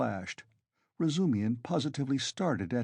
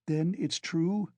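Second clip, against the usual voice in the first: slow, higher, anxious question; cried; 7 semitones higher, slower than usual. A narrator's reading. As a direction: ask in fearful disbelief. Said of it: "cried Pulcheria Alexandrovna".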